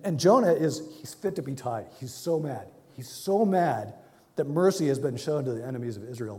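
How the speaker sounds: usual speaking voice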